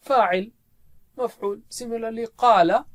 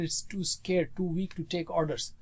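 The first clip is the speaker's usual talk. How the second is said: usual speaking voice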